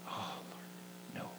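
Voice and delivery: whispered, pleading no; reluctant whisper. Pretend. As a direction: breathe a whispered refusal. His reluctant reply to God.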